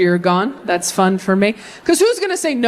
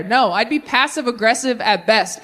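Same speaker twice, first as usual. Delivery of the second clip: normal talking voice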